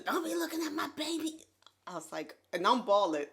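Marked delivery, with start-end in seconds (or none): 0.0-1.4 mock protective anger. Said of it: "You went off on that too".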